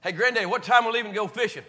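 loud and high, calling out; calling loudly to a grandfather who can't hear; shouting to grandpa. Retold or imitated. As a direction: holler the question up at him.

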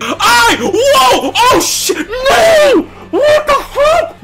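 very high, loud yelps of disbelief; shocked screaming. Real, not acted. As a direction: scream as the disaster unfolds. Shocked by a sudden attack.

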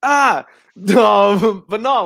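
real laughing shout; laughing protest. Real, not acted. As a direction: burst out laughing in denial. Laughing denial.

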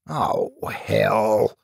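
drawn-out, mild groan of dismay. A narrator's reading. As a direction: groan it in resignation. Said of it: "he groaned".

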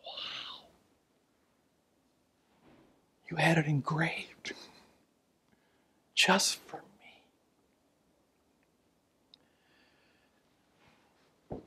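whispered, slow amazement; awed whisper. Pretend. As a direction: whisper it in wonder. Client's awed whispered reaction.

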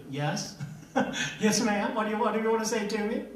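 quick, eager, lightly voiced obedient husband; dutiful husband. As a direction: jump to attention for the wife, half laughing. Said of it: "when your wife wants to talk to you, this is what you do".

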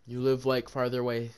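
ordinary talk, nothing marked

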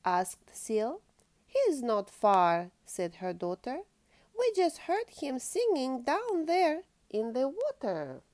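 lively storybook reading aloud; reading to children. Acted. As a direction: read it like a bedtime picture book. Reads picture book to child.

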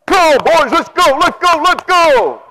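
loud, high coach yelling at the crew; rally the workers like a coach on the sideline; football coach shout. Retold or imitated. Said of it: "he sounded like a football coach".

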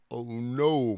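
ordinary delivery, nothing marked

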